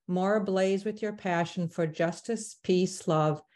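ordinary talk

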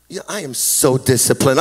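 low-pitched mock self-congratulation; mock boasting. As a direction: pretend to be hugely pleased with yourself. Mock boast, then says just kidding.

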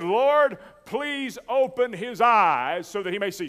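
loud, high preacher's cry to the Lord; shouted prayer. Retold or imitated. Preacher acting out the prophet praying for his servant, shouted prayer. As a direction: call the prayer out aloud, high and pleading. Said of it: "Shouted aloud".

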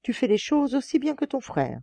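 understated narrated enthusiasm; enthusiastic. A narrator's reading. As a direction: praise him like an impressed father. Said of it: "s'enthousiasma le père".

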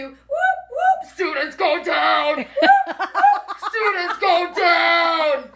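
very high whooping siren, then a loud, slow, drawn-out shouted order; alarm siren imitation. New lockdown alarm blaring at the students, mock siren and loud shout. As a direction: wail the whoops like an alarm, then yell the command.

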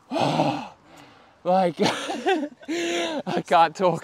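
breathless, panting between words; out of breath from hauling. Real, not acted. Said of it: "I'm out of breath".

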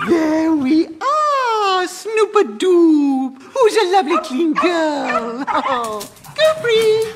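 high, slow coo to a pet dog; doting baby talk. Acted. [name] cooing to her freshly washed dog, doting baby talk. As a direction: gush over the dog like a baby.

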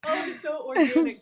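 high voice full of laughter; laughing delight. Real, not acted. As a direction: talk through giggles. Host laughing at co-host's habit.

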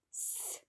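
С as one long sustained hiss; isolated S sound. Shown as a demonstration. Teacher modelling the sound for children, isolated S. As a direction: hold С with no vowel after it. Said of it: "звук С".

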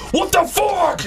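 high shouted mock road rage; Tomar. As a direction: explode like a friend raging behind the wheel. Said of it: "But on the road".

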